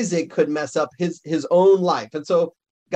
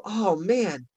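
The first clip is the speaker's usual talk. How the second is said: high, chatty curiosity with a laugh; eager fan; 6 semitones higher than usual. Pretend. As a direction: pepper him with eager questions. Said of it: "you go".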